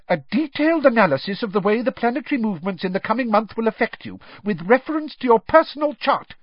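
a stern teacher cutting the class short; snapped. A narrator's reading. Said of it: "she snapped".

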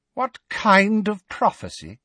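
high mocking witch voice; Bellatrix repeating. A narrator's reading. Bellatrix mocking Harry's question, high sneering witch. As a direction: echo his question with a sneer. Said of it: "repeated Bellatrix".

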